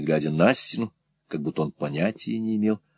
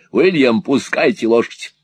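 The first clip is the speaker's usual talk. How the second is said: raised, urgent command; called-out order; 4 semitones higher, 4 dB louder than usual. Acted. Sir Mulberry ordering the groom to drive, called out. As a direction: call out sharply to a servant.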